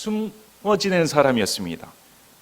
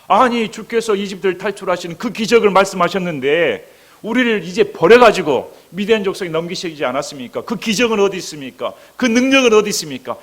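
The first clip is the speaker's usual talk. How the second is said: raised, higher-pitched voice of grievance; complaining protest; 6 semitones higher, 4 dB louder than usual. Acted. Gideon confronting the Lord's angel about being abandoned, complaining. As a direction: press each question as an indignant challenge. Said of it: "기드온은 불평을 합니다".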